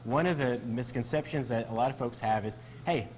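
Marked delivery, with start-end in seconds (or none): none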